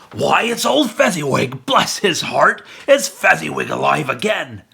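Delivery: put-on Scrooge spots his old master, crying out; great excitement. A narrator's reading. Scrooge recognizing old Fezziwig, excited cry. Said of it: "Scrooge cried in great excitement".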